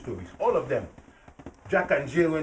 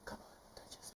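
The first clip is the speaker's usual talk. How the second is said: breathy unvoiced whisper; quiet whispered urging; 29 dB quieter, faster than usual. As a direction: whisper it under the story. Whispered aside.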